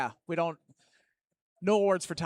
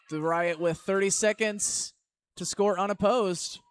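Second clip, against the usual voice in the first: excited commentary; 5 semitones higher, 6 dB louder than usual. Real, not acted. Sudden big hits on the track.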